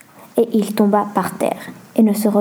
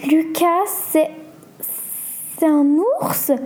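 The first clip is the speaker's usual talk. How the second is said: higher, halting and trembling with fear; fearful stammer; 5 semitones higher, slower than usual. A narrator's reading. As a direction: stammer it in mild acted fright, with gaps between words. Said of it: "murmura Julia en tremblant de peur". (bégaiement apeuré)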